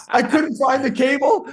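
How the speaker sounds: high, loud, through laughter; laughing confession. Real, not acted. Speaker confesses to hosts about the cable, laughing. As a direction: crack up while admitting it.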